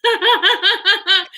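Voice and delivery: high, laughing agreement. Real, not acted. Host laughs along at co-host's Southern accent. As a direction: giggle through the words, slow.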